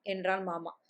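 usual speaking voice

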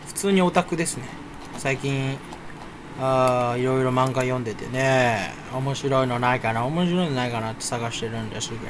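ordinary delivery

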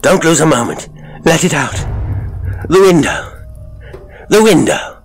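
high, loud, urgent cries; excited cry. A narrator's reading. Gilday begs his friend to let it out, crying urgently. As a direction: build to frantic, high-pitched commands. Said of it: "he cried".

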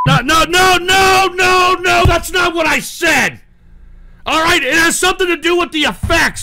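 high, loud shouted denial; agitated protest. Real, not acted. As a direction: shout it down in a rising panic of no's. Protests angrily after clip.

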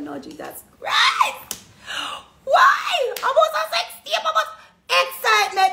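high, loud hammed-up cry of alarm; over-the-top retold panic; mock panicked shout. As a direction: shriek it like the world is ending. Retelling panic over sex tape.

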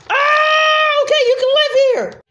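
very high, loud, fast panicked giving in; panicked scream. Acted. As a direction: shriek and cave in at once. Terrified character gives in screaming.

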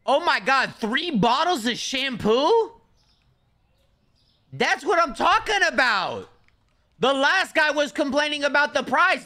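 high, loud excited shopper; put-on customer voice. Streamer voicing a happy customer, high and loud. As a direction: play a thrilled customer in a high voice.

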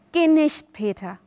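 imploring, falling away on the name; pleading. A narrator's reading. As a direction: beg them to stay. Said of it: "flehte sie".

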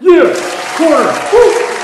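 loud high cheers; cheering. Real, not acted. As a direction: celebrate loudly. Celebrating getting a desk.

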